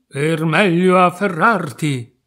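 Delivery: lightly acted predator, loud and slow; menacing wolf. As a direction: draw it out with growing menace. Wolf posing as grandmother, menacing.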